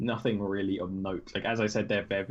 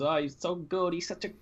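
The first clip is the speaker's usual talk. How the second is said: mocking fanboy gush; 7 semitones higher than usual. GM voices Turnan mocking Bjor.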